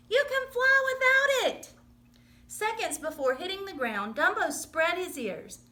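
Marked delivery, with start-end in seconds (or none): 0.1-1.7 much higher, pressing encouragement; urgent cry. Acted. Mouse calling to falling elephant, high urgent cry. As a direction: urge him on at full voice. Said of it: "cried Timothy".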